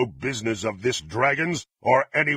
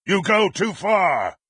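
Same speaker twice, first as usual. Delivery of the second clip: high furious roar of a villain; enraged shout; 7 semitones higher, 4 dB louder than usual. Acted. Villain shouting his rage at the dragon, furious shout. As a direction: shout it out, fury boiling over.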